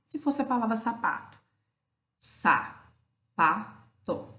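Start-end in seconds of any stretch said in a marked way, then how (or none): 2.2-4.3 sa, pa, to as three separate beats; syllable by syllable. Shown as a demonstration. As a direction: break it into sa, pa, to with gaps. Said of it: "três pedaços, três sílabas".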